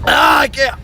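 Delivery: loud cry of burning pain; pained shout. Real, not acted. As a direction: yell it out through the burn. Burning mouth from hot wing.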